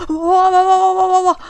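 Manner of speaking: high, loud startled wa wa wa; panicked yelping. Real, not acted. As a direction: yelp over and over in fright. Sudden scare in game.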